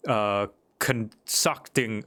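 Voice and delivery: drawn-out, hesitant concocting; slow stumble. Acted. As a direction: sound out concocting slowly. Stumbling over the word.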